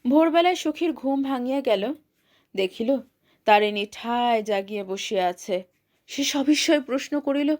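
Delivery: usual speaking voice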